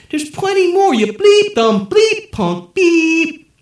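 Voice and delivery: high, loud raging taunts; furious fight taunts. A narrator's reading. The president taunts the viewers while fighting, loud and high. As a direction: scream insults while throwing punches.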